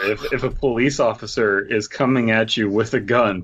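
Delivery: ordinary delivery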